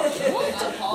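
high-pitched, appalled "what"; appalled mock gasp. Preacher re-enacting his shock at zero response, appalled. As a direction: squeak it out in mock disbelief.

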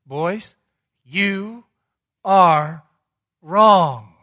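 put-on "Boys, you are wrong" swelling to a loud, ringing "wrong"; preacher's booming rebuke; emphatic declaration. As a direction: build to a loud flat verdict. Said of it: "with this affirmation".

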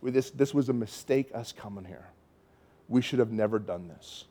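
discouraged inner voice. Retold or imitated. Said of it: "inside I'm just like".

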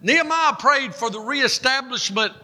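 high, impassioned sermon delivery; full-force preaching. Real, not acted. Preacher urging congregation to fast for deliverance, impassioned.

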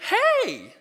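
high, loud sing-song "hey"; mama greeting baby. Acted. Mama comes along to her baby, high excited greeting. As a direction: coo it brightly and loudly, way up high.

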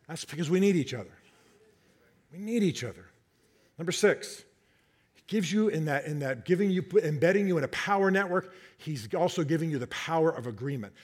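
usual speaking voice

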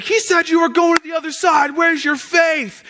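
high-pitched mock rebuke; mocking scolding. Churchgoers making fun of the disciples, high mocking reproach. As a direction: scold them high, drawing out the last question. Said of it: "we always make fun of the disciples".

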